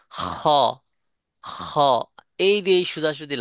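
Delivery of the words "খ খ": খ said alone, crisply from the upper throat; demo sound kha. Shown as a demonstration. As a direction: say খ slowly from the start of the throat. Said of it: "একটু ভালো করে দেখবেন". (খ হরফটি গলার শুরু থেকে আলাদা করে উচ্চারণ)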